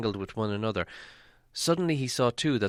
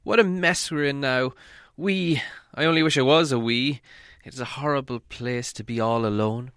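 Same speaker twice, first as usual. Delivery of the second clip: lament; 4 semitones higher, 3 dB louder than usual. Acted. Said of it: "he lamented".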